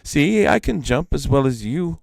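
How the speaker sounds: a lifted, boastful bird voice; boasting sparrow voice. Acted. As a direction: show off like a little bird. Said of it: "went on Iktomi".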